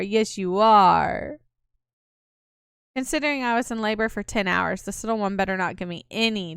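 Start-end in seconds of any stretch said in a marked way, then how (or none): 0.0-1.4 drawn-out cooing, very slow with a rising end; slow baby talk. Acted. Cooing slowly to the baby in the mother's arms, drawn out.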